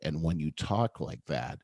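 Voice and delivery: low, soft, calming delivery; late-night DJ voice. Shown as a demonstration. Showing how to calm someone highly emotional, late-night DJ voice. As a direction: let each phrase trail gently downwards. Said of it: "I was talking like that on purpose".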